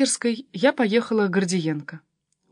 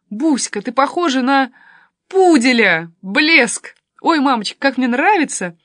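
loud, higher exclamations of delight; delighted raving; 5 semitones higher, 8 dB louder than usual. A narrator's reading. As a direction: gush with admiration. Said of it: "Венька пришёл в восторг". (в полном восторге)

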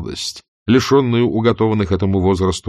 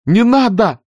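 much higher and louder, fierce; furious cry; 13 semitones higher, 5 dB louder, slower than usual. Acted. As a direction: shout it out in fury. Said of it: "яростно вскричала вдруг Скарлетт". (яростно вскричав)

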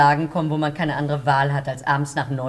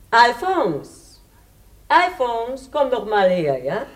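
calling out; 7 semitones higher, much slower than usual. Acted. Calling husband from the door.

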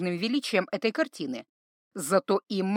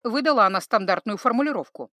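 plain reading voice

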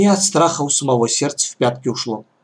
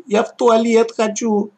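put-on high whiny little boy; whining; 9 semitones higher than usual. A narrator's reading. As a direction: whine like a needy child. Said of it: "жалобно заныл Лёшка". (ноющий ребёнок)

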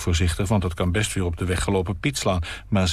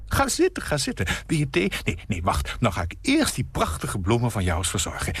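fussy hostess. Acted. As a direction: chatter as you fuss over the flowers. Nina fusses with the vase.

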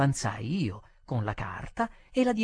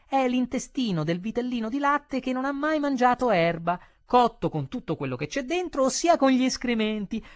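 high-pitched put-on voice; menu reader's explanation; 9 semitones higher, 4 dB louder than usual. A narrator's reading. Diner reading the menu explains the dish, high-voiced.

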